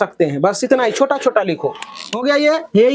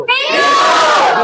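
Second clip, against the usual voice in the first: bellowed answer; much slower than usual. Real, not acted. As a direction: bellow it out slowly at a high pitch. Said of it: "अब ऐसा ढाकर के नहीं".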